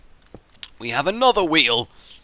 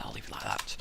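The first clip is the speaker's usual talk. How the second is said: whispered to himself, much softer; 3 semitones lower, 15 dB quieter than usual. Real, not acted. Builder muttering to himself, a soft whisper. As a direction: breathe it like a secret.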